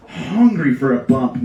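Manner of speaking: normal talking voice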